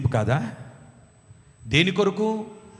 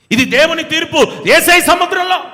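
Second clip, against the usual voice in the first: higher and louder urging; urgent cry; 9 semitones higher, 6 dB louder than usual. Acted. Jonah urging the sailors to throw him in, rising and loud. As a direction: insist they throw him overboard.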